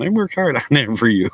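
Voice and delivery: a touch of hurt daughter; lightly voiced daughter quote; imitating the daughter. Quoting the woman again.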